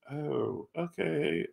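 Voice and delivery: soft, unhappy mock agreement; reluctant agreement. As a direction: retell it quietly, clearly not happy. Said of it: "not happy about that".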